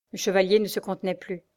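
usual speaking voice

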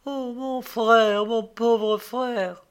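high, very slow moan of grief; acted sorrowful wailing; anguished lament. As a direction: drag it out, mourning a brother. Maid laments her doomed brother.

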